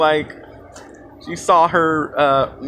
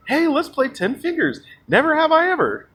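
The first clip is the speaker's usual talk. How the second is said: high-pitched eager mock voice; mocked eager guy; 11 semitones higher than usual. Pest proposing a drinking game, high eager mock voice.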